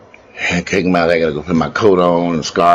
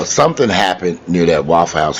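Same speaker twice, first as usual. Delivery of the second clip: ordinary talk, nothing marked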